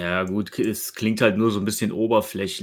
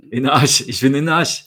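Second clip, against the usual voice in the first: louder repeat; 4 semitones higher, 7 dB louder than usual. Real, not acted. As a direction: say it louder, clearly. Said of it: "was denn?".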